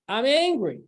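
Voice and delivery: snapping in anger; angry outburst. Shown as a demonstration. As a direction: let the anger flare out. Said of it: "And then you stop and say".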